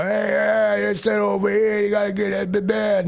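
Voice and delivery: hammed-up brash tourist calling out; loud tourist. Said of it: "some loud fucking tourist".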